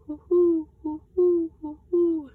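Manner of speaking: high loud hooting like an owl; Pa hoots to find owls, high loud owl call; great horned owl call. Acted. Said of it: "the sound of a great horned owl".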